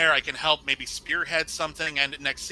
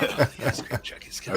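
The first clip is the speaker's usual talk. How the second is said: spooky voice; 4 semitones lower than usual. Retold or imitated. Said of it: "now it sounds spooky".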